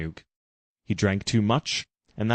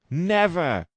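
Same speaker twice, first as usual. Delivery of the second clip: weak never; 8 semitones higher than usual. Acted. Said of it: "said Jim weakly".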